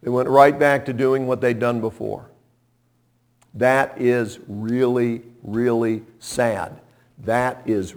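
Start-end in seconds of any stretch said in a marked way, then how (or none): none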